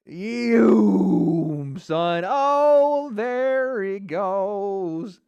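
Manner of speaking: loud high cheer as the knockout lands; excited cheering. Real, not acted. Streamer yelling at fighter scoring knockout, loud excited cheer. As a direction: yell him on, all hype.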